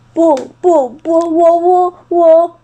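terrified uncle unable to speak; panicked stammer. Acted. T-rex panics.

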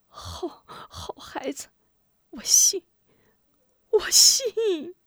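faint whispery words rising to a high voice; breathy high mother's voice; moved, breathy mother. A narrator's reading. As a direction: answer haltingly, overcome, on little breath. Captive mother answers her son's promise.

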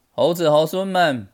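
high, loud rallying call. A narrator's reading. Sun Wukong addresses all his monkeys, calling out. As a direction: summon the whole troop.

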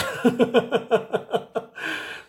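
high rising laugh. Real, not acted. Mocking the plain customer, high laugh. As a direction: break into laughter. Laughing at her own joke.

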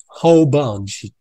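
"Whole bunch" said slowly, stress on Whole; slow demo. Shown as a demonstration. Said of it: "整个的". (慢慢念 Whole bunch)

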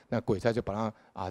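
normal talking voice

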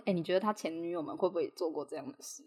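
quiet, dry teasing question; sarcastic jab. Retold or imitated. Friend teasing her about his ex-girlfriends, sly sarcastic tone. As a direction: needle him with a soft sarcastic dig. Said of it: "我就讽刺他".

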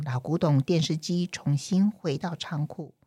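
plain reading voice

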